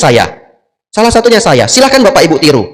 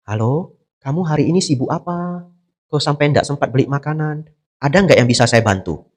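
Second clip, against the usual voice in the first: soft, gentle concern on a phone call; perhatian, caring; 8 dB quieter than usual. Acted. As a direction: ask softly, offering help. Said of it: "ada yang malah perhatian". (lembut penuh perhatian)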